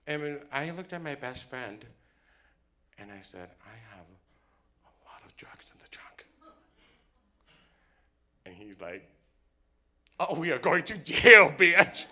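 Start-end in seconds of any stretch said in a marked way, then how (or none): 3.6-6.3 very soft whisper; hushed confession. Acted. Confiding in friend as cop approaches. 10.2-12.1 loud, high-pitched shout; panicked outburst. Acted. Friend reacts to drugs in trunk.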